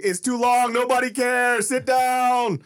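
high, loud jeering at the speaker; mock heckling. Guest reenacting heckling a drunk friend's long speech, loud and jeering. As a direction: boo him off the stage, hammed up. Retelling booing the wedding speech.

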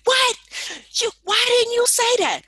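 high, loud startled shout; shocked friend. Retold or imitated. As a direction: blurt it out in shock. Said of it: "He was like".

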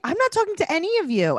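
put-on high little girl's huff; imitating a sulky girl. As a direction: sulk it out like a pouting four-year-old. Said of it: "She's like".

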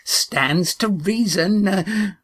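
put-on very high, slow old woman's reasoning; old grandmother. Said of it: "the grandmother went on".

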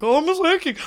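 high, loud mock-eager outburst; over-eager announcement. Retelling pestering a celebrity heading home, loudly over-eager.

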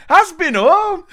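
high, loud broad Yorkshire laughing; Happy Valley Yorkshire lad; Yorkshire accent. Retold or imitated. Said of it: "Happy Valley style".

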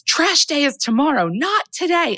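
high-pitched mock yell; put-on screamed outburst. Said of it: "and, like, screams".

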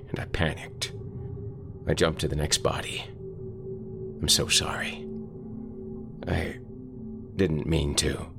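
soft, half-whispered remorse; a quiet, broken apology; hushed and guilty. Acted. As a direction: confess it softly, ashamed and scared. Carl hushed, guilty on the phone.

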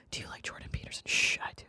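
secretive hushed whisper. Pretend. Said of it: "In hushed tones".

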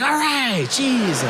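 high-pitched defensive exasperation; exasperated retort. Acted. Told to take it easy, exasperated high retort.

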